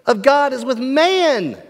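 high, booming-loud proclamation; loud voice from heaven. Acted. Voice from the throne announcing God dwells with man, loud proclamation. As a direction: declare it from the throne. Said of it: "I heard a loud voice from the throne".

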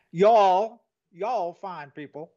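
slow, drawn-out Southern "y'all"; mock Southern drawl. Correcting the Irish version for Charleston cousins, mock Southern drawl. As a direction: stretch it into a playful Southern drawl.

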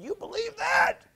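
mocking disbelief. Imitating the man who mocks the preacher's Bible verse, high jeering voice. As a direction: mimic the scoffer's high sneer. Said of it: "this guy's pointing in my Bible saying".

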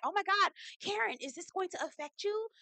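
high-pitched imitation of showy concern; messy mock consideration. Stacy checking if champagne will affect Karen, high mock concern. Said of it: "she was being messy or trying to be considerate".

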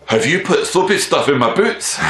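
angry Scottish lad, pitched high and loud; Scottish accent. Retold or imitated. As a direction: do the irate Scottish student demanding answers. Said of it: "So here's my Scottish accent".

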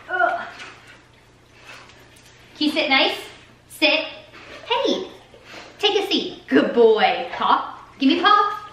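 high coaxing dog-owner voice; pet voice to dog. Real, not acted. Telling her dog Marshall to sit, high coaxing pet voice.

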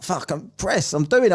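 ordinary talk

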